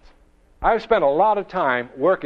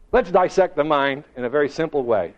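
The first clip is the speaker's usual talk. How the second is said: ordinary talk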